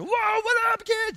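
very high, loud playful holler; rowdy wake-up. Acted. Dad jumping on the kid's bed, rowdy shout. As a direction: burst in and startle the kid awake. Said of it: "I'm just like".

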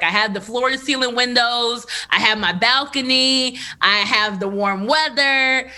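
usual speaking voice

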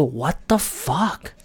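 slow disbelief. Retold or imitated. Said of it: "I go".

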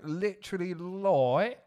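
"light" drawn out as British "loi"; slow British lad vowel on "light"; British accent on "light". Shown as a demonstration. Co-host shows the accent's anchor, British "loi" for "light". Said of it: "like will keep you in the accent".

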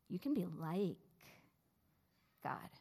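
"like" stressed with a falling tune; the serpent teasing "like". Shown as a demonstration. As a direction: lean into "like", fall away after. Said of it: "emphasizing different words each time".

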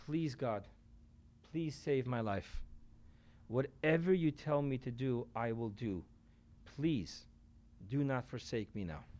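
soft, desperate prayer; man on the cliff begging God softly; quiet plea. Retold or imitated. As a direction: beg God softly for your life. Man hanging on cliff prays.

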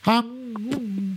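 humming with nose pinched shut; nose plugged. Real, not acted. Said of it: "Now try to hum".

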